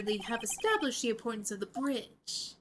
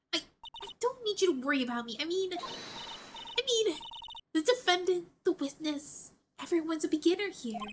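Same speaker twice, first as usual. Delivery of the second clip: higher-pitched, halting stammer; flustered protest; 6 semitones higher than usual. Acted. Rookie lawyer brushing off a jibe about beginners, flustered. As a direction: stumble while insisting all is fine.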